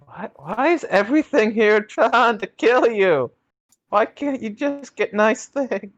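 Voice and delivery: high, pleading exasperation; plead, fed up with it all; exasperated whine. Acted. Exasperated at deadly station.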